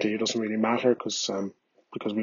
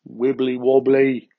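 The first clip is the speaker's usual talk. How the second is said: silly voice; slower than usual. Pretend. Silly closing put-on voice.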